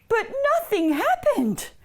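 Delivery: high, singsong storyteller's dismay; exaggerated disappointment for children; dramatic letdown. A narrator's reading. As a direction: swoop up high, then fall away. Pull fails in children's story.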